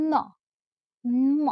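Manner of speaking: teacher sounding out ন, ম as a blended cluster; joined-sound demonstration. Shown as a demonstration. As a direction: hold a humming n on ন, then release. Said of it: "যদি একসাথে উচ্চারণ করে".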